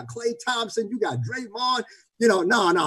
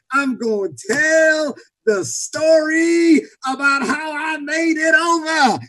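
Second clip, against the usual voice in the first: stretched half-sung preaching, rising at the end; rousing sermon crescendo; 7 semitones higher, 3 dB louder than usual. Real, not acted. Preacher quoting a gospel song without a choir, rising half-sung crescendo. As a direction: build to a high, slow, held cry.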